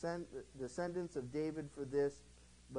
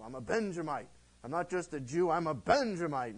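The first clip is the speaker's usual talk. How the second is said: proud boast; 3 semitones higher, 7 dB louder than usual. Retold or imitated. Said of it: "Paul puts a little pride in himself".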